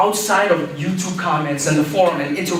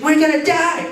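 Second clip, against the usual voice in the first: high, loud shrieking panic; mock-hysterical old lady, much higher; panicked old lady; 10 semitones higher, 3 dB louder than usual. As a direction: squeal like you're sure you'll die.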